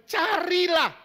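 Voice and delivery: a loud, high shout of "carilah"; shouted command. Acted. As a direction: call the word out to the congregation. Preaching scripture.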